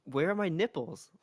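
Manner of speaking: put-on dad doing his little girl's voice; young daughter's voice. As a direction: mimic your small daughter repeating her question. Repeats young daughter's question.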